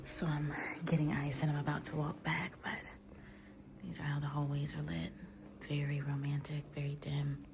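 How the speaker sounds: soft hallway whisper; hushed, so as not to disturb guests. Real, not acted.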